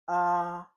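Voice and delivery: AH held high and level; first tone. Shown as a demonstration. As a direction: Mandarin first tone on AH, high, steady and loud. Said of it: "The first tone is this".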